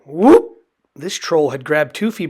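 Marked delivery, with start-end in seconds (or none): 0.0-0.6 surprised whoop. A narrator's reading. Surprise noise.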